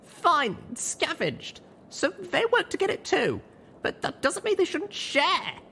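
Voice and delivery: put-on high, heated young mare; voice rising. A narrator's reading. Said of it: "My voice was rising".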